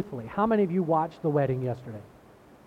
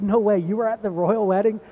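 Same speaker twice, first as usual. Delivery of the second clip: loud, higher excited disbelief; surprised; 5 semitones higher, 8 dB louder than usual. Retold or imitated. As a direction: burst out in amazed disbelief. Retelling his own surprised reply.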